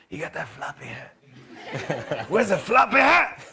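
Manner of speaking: lightly voiced Bruce getting giddy; excited Bruce. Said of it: "he goes".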